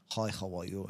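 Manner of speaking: low, quiet imitated Russian accent. Programme head welcoming the newcomer, in an imitated Russian accent. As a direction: put on a soft, deep Russian accent. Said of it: "You know, with Russian accent".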